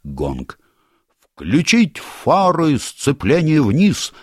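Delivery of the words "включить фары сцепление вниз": high, slow shouted orders over the engine; shouting. Acted. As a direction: bellow the commands over a roaring motor. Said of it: "крикнул Ринсмангл, перекрывая шум двигателя".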